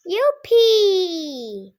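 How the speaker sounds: loud high whoop of joy; excited cheer. Shown as a demonstration. Kid telling mom the McDonald's plan, excited shout. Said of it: "But when I, when I said".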